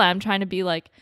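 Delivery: ordinary talk, nothing marked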